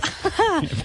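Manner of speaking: sudden high-pitched giggle; high squealing laugh. Real, not acted. Host laughs at the joke.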